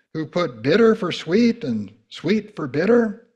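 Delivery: loud, ringing preacher's proclamation; forceful scripture reading. Acted. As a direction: thunder the woes out.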